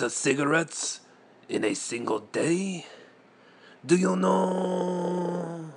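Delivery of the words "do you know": high slow drawn-out question. Shown as a demonstration.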